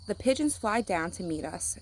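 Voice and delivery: plain reading voice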